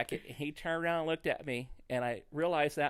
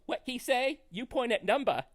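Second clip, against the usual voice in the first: high, loud mock Chinese accent; horrible Chinese accent; 10 semitones higher, 4 dB louder than usual. Chinese waiter puzzled by the diner's order, horrible Chinese accent. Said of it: "this is gonna be a horrible accent".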